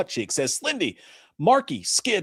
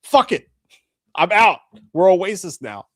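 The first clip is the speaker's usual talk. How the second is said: loud fed-up outburst; shouting quit; 5 semitones higher, 8 dB louder than usual. Retold or imitated. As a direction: yell it like you're quitting. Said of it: "He was like".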